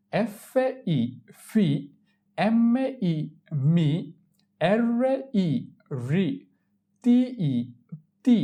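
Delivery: very slow "এফএই", pause, then blended "ফি"; syllable drill. Shown as a demonstration. Teacher drills learners on consonants with ই, slow spelling-out. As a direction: stretch "টি ই" high, then land on a short "তি". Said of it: "তার উচ্চারণ কিভাবে করতে হয়".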